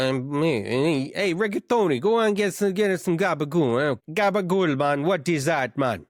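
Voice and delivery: put-on sending reggaetoni for gabagool, Jersey Italian accent; New Jersey Italian accent. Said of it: "That's a half-decent accent".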